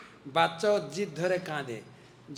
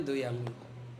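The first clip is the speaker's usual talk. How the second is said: hushed reveal; 7 semitones lower, 8 dB quieter than usual. A narrator's reading. Rope falling short again.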